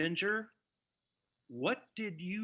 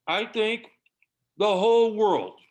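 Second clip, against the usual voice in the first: sarcastic; 2 semitones higher, 7 dB louder than usual. Acted. Husband mocks everyone for listening.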